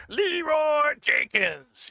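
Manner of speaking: high, loud, drawn-out battle cry; Leroy Jenkins. Acted. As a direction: stretch the name into a high yell. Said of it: "I can't do that voice".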